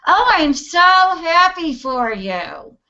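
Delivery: high, slow, sugary fake congratulation; fake sweet. Faking joy to a friend, slow sugary fake cheer. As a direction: gush it sweetly while not meaning it. Fake happiness, jealous inside.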